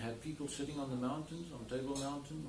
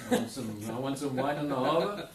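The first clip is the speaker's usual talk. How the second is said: much louder mock demand from a customer; hammed-up order from a customer abroad; 3 semitones higher, 11 dB louder than usual. Owner mimics a German customer's email, mock demanding and loud. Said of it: "I get an email from Germany".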